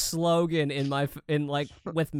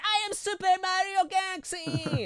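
put-on high, excited little-kid voice; dad mimicking his son running from bedtime; imitating his kid. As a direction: run around yelling it like a small boy. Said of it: "he's running around going like".

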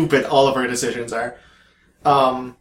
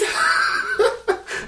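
high burst of laughter; laughing. Real, not acted. Laughing at own invented accent, helpless laughter. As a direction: crack up at your own joke.

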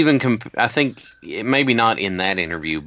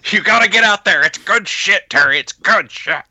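pushy mock Vince; 9 semitones higher, 5 dB louder than usual. Mock Vince pushing again.

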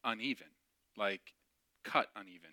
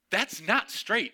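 higher, louder and slow: that's not straight; firm correction; 9 semitones higher, 7 dB louder, slower than usual. Retold or imitated. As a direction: insist slowly and firmly. Boss corrects the mat cutter.

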